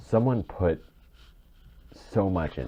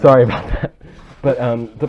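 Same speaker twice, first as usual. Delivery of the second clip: much higher and louder, real tears breaking through; tearful apology; 8 semitones higher, 12 dB louder than usual. Real, not acted. Guest apologizes to cohost mid-tears, voice high and loud. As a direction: apologize while crying and try to carry on. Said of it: "I'm actually crying".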